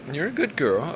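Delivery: high sweet pet praise; praising the dog. Real, not acted. Praising his dog, high coo.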